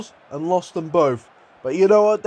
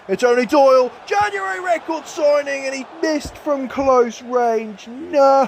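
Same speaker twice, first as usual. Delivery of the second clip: frustrated shout; 7 semitones higher than usual. Real, not acted. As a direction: shout in disbelief at the wasted chance. Striker misses a sitter.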